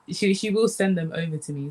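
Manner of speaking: ordinary delivery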